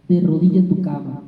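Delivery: ordinary talk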